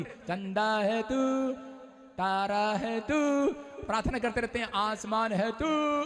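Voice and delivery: slow, sing-song mocking of flowery prayer; hammed-up slow adoring chant; drawn-out mock praise. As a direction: stretch each तू out like a showy prayer. Mocking showy prayer lines.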